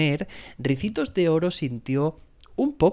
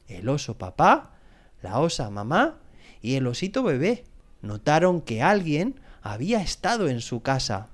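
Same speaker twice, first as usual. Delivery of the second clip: usual speaking voice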